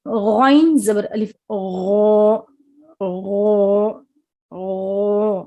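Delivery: غو said thick from the throat; slow throaty ghain drill; heavy ghain. Shown as a demonstration. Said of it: "اس کو موٹا پڑھیں گے".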